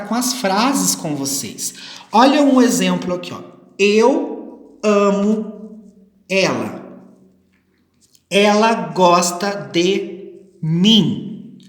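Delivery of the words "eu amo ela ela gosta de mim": very slow, word by word; deliberate teacher dictation pace; slow example sentence. Shown as a demonstration. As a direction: spell out the example with pauses between words. Reading out a model example sentence.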